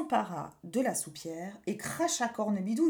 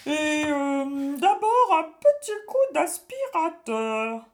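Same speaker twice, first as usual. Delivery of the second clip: high, loud, slow witch; a witch gleefully making mischief; sneaky cartoon thief; 5 semitones higher, 7 dB louder, slower than usual. A narrator's reading. Witch scheming inside belly.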